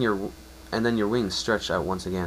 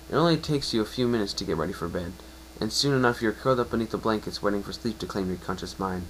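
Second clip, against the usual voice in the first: plain reading voice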